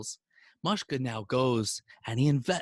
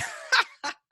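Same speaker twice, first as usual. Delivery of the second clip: high, put-on laugh; acted high-pitched laughing; mock laughter. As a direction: laugh scornfully as the landlord. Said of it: "starts laughing".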